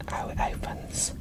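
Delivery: ordinary talk, nothing marked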